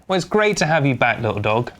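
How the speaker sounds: ordinary talk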